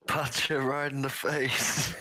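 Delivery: high, drawn-out jeer; playful high-pitched ringside heckle; heckling from the crowd. Acted. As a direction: egg on the fighter, stretching the words. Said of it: "Corridon just goes".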